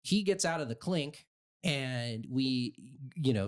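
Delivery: ordinary talk, nothing marked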